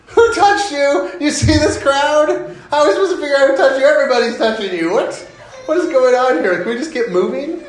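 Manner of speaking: high-pitched mock disbelief, pushing to move on; incredulous disciples. Disciples fed up with Jesus stopping in the crowd, incredulous. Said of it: "The incredulousness of the disciples".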